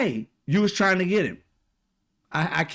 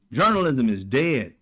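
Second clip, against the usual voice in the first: ordinary delivery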